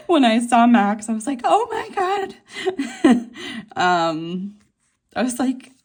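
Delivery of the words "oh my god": high, loud retold fan squeal; starstruck gasp. Fan retelling first sight of Max, high starstruck squeal.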